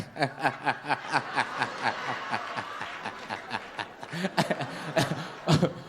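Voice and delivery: drawn-out, rising mock laugh; long mocking laugh. His laugh after her no.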